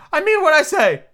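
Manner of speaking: high, loud, indignant retort; offended. A narrator's reading. Mock Turtle answering Alice, offended. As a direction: insist on it, affronted, way up high. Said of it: "replied in an offended tone".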